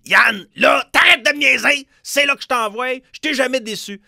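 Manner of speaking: high, loud shrieking princess voice. Retold or imitated. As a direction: shout it like a spoiled cartoon princess. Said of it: "avec, euh, sa voix de, de princesse".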